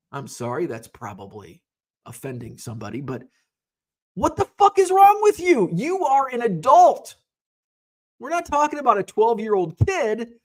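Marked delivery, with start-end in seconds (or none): 4.1-10.3 high, loud exasperated outburst; venting fury at a grown man's stupidity; furious rant. Real, not acted. To viewers about the careless dealer, furious outburst.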